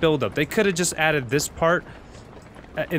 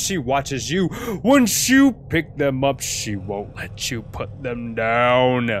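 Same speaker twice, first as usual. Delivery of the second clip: loud, high, intense declaiming; dramatic reading; 5 semitones higher, 7 dB louder than usual. Acted. Gamer reading a creepy note aloud, dramatic and loud. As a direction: read it out like a frenzied horror monologue. Let me read this.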